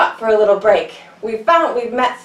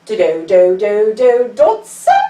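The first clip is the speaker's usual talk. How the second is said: dodo call; 4 semitones lower, 5 dB louder than usual. Shown as a demonstration. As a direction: chant it like a proud little bird. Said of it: "we'll say".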